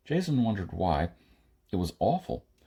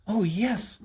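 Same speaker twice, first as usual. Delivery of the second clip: put-on much higher, gleeful girl; delighted; 12 semitones higher than usual. Maida hears about the new weapons, gleeful and high. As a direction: squeal it with childlike joy. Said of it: "almost clapping her hands with happiness".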